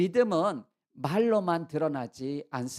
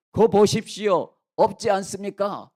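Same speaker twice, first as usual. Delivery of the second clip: loud, confident challenge; emboldened vindication; 3 semitones higher, 7 dB louder than usual. Acted. Brothers during the sack search, emboldened loud challenge. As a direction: throw it out loudly, sure of being proven right. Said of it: "점점 그냥 어깨가 힘이 들어갔을".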